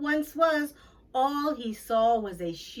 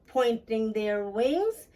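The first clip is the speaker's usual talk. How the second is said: ordinary delivery, nothing marked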